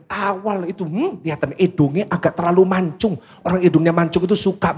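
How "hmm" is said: ordinary talk, nothing marked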